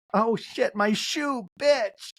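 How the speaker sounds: high-pitched sudden outburst; mock alarmed exclamation. Castmate realizing her shoe is gone, mock alarm.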